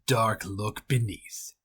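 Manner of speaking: ordinary delivery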